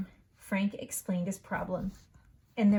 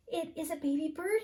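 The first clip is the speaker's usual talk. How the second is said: surprised realisation; 8 semitones higher, faster than usual. Acted. Frog realises.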